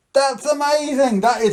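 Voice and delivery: drawn-out high fake wonder; mock amazement. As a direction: gush over it, slowly and high. Trick fails, mock amazement.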